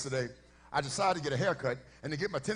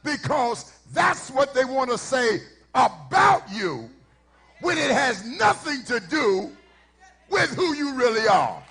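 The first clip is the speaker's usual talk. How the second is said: raised, loud preacher's cadence; preaching; 5 semitones higher, 8 dB louder than usual. Acted. Pastor preaching to the congregation, loud and fervent. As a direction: drive the point home from the pulpit. Preaching climax before story.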